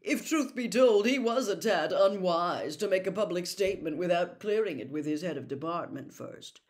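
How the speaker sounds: a stuffy know-it-all setting his family straight; pompous Percy. A narrator's reading. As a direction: lecture them like a junior official.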